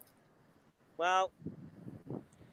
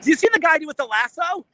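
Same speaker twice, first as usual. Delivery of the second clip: louder and higher, real delight at the fireworks; excited; 9 semitones higher, 8 dB louder than usual. Real, not acted. Host on the roof pointing out fireworks to the co-host, excited. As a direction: call out thrilled, pointing something out.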